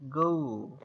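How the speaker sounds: "ago" pulled out in a long falling glide; slow teaching model of "ago", long final vowel; drawn-out wave. Shown as a demonstration. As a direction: stretch the o of "ago" like a rolling wave. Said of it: "একটা ঢেউয়ের মতো টান দিয়ে যাচ্ছে".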